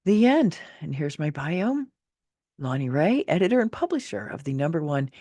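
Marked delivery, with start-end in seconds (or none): 0.0-0.6 high, loud announcement; bright finale. Real, not acted. Author proudly announcing the end of her chapter. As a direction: announce it with a flourish.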